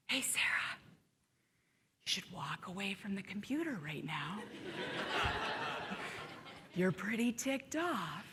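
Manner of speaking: soft whispered warning from the inner voice. Retold or imitated. The calm inner voice warning Sarah, whispered. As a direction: breathe it like a calm voice in her head. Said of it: "let's go to this voice".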